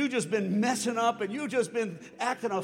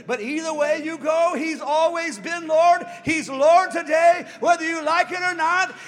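preaching at full force; 5 semitones higher, 10 dB louder than usual. Real, not acted. Preacher proclaiming Jesus as Lord, full shout. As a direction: pound each word.

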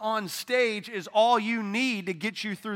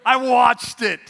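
laughing; 5 semitones higher, 8 dB louder, slower than usual. Real, not acted. Laughing correction.

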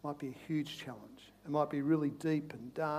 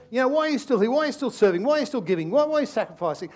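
loud, higher nagging questions; taunting enemy; 7 semitones higher, 8 dB louder, faster than usual. Acted. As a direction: keep needling why, loudly.

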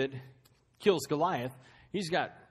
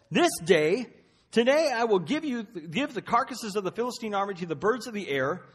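defiant proclamation; 5 semitones higher, 5 dB louder than usual. Acted. David's defiant speech to Goliath.

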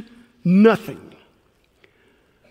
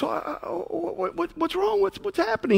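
high, hushed bewildered stammer; a confused husband's hushed, high panic; baffled husband; 7 semitones higher, 10 dB quieter than usual. Retold or imitated. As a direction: stammer it out, totally thrown. Husband baffled by crying wife.

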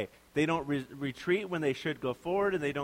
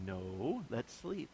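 sleepy grumble; 4 semitones lower, 9 dB quieter, much slower than usual. Pretend. Tired reply to excited person.

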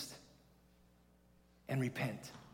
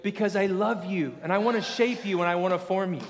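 louder, higher, pleading with force; passionate voice of God; 5 semitones higher, 6 dB louder than usual. Retold or imitated. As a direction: press the love home, loud and urgent. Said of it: "He's like".

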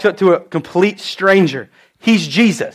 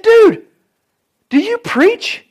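high, amused mock surprise; much higher, playful retold question; surprised question; 12 semitones higher, much slower than usual. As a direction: ask it like you can't believe it. Retelling a surprised question to a friend.

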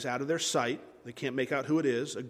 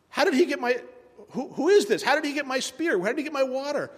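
high, stammering disbelief; bewildered alarm; 9 semitones higher than usual. Acted. Saul seeing his spear held up, bewildered. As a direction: blurt it high, tripping over the questions.

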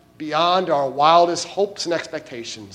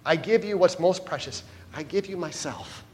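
soft, tender, hushed; a lightly voiced, quiet Jesus; gentle voice of Jesus; 9 dB quieter than usual. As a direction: speak as Jesus offering himself, softly. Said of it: "And Jesus says".

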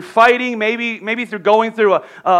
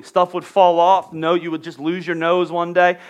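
normal talking voice

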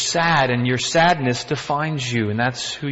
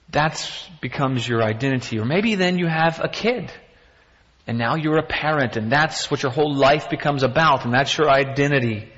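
normal talking voice

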